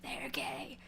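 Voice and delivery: whispered secret, very soft; conspiratorial whisper. Retold or imitated. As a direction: lean in and whisper it. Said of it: "I'm like".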